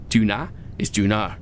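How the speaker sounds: usual speaking voice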